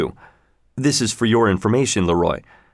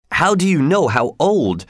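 higher-pitched, eager questioning; excited question; 6 semitones higher than usual. A narrator's reading. Leroy asking Jarvis about the creature's age, excited. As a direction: ask it eagerly, pitch lifted. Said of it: "Leroy asked excitedly".

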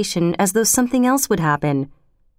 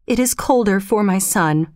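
ordinary delivery

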